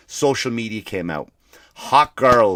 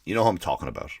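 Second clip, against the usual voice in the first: normal talking voice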